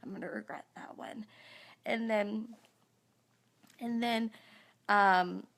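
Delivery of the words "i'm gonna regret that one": fast, whispered regret; embarrassed whisper. Real, not acted. Realising she cussed on live Facebook, guilty whisper. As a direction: hush it like a guilty confession. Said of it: "I should not have said hell".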